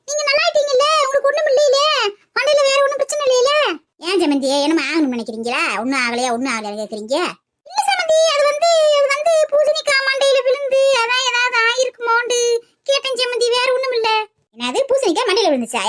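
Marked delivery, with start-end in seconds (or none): 0.1-3.5 high-pitched, bossy granny voice; bossy old woman. Acted. Grandmother insisting to the kids, high and bossy. 7.7-14.1 high, nervous backpedaling; flustered excuse. Acted. Woman covering up to her in-law, high and flustered.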